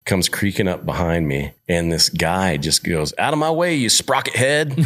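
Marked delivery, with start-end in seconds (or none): 3.2-4.8 high-pitched mock holler at someone in the way; mock heckling call. Jeep driver to cyclist blocking the trail, mock heckling call. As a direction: call out an insult, imitating a passing driver.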